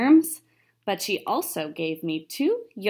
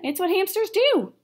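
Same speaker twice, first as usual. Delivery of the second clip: hamster's answer; 11 semitones higher than usual. Acted. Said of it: "I said".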